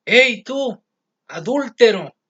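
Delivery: loud, high-pitched shouted accusation; accusing shout. Retold or imitated. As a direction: call out the sinner at the top of your voice. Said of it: "y le grita".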